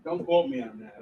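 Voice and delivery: ordinary talk, nothing marked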